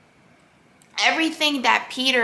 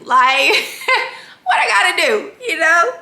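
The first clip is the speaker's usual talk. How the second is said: higher and louder, laughing through the words; laughing excitedly; 7 semitones higher, 8 dB louder than usual. Real, not acted. To her viewers, wanting the same abundance, laughing excitedly. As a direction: giggle it out, half joking about wanting more.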